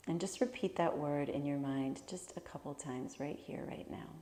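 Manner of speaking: calm, measured meditation voice with long pauses; guided meditation. Real, not acted. Teacher leading listeners to imagine their favorite place, guided meditation. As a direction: guide them gently through a visualization, leaving space to breathe.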